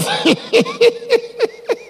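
high, gleeful burst of laughter; laughing. Real, not acted. Preacher amused by his own daddy example, laughing.

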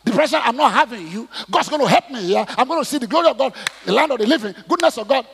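loud, high, emphatic faith declaration; preacher rebuking depression at full voice; defiant declaration. Retold or imitated. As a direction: stand up to depression, loud and forceful. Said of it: "I just get up and say".

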